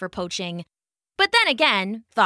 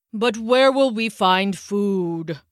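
slow, whiny half-giant voice; whiny gruff voice; slower than usual. Acted. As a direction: whine it like a big gruff giant. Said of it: "whiny question, delivered in his deep, gruff voice".

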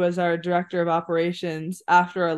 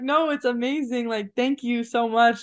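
retold gratitude; 6 semitones higher than usual. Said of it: "I was like".

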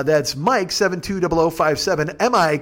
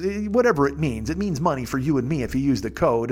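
normal talking voice

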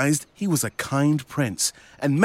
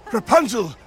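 high, tearful prince. Acted. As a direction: cry out in hopeful disbelief. Blind prince hears her.

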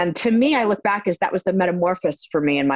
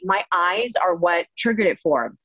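usual speaking voice

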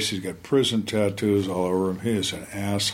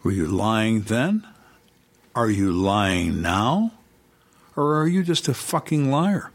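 slow, deliberate accusing questions; acted menacing interrogation; Antichrist interrogator; slower than usual. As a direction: bear down on each question, drawn out. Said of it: "change from Mister Nice Guy to the Antichrist".

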